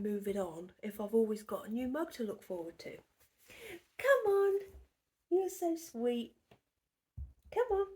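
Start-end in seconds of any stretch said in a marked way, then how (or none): 4.0-7.9 much higher, louder coaxing coo. Real, not acted. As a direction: coo at him to come over. Coaxing Ian, baby talk.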